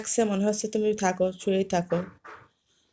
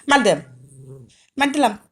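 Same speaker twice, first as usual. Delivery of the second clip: mock threat; 8 dB louder than usual. Threatening pet playfully.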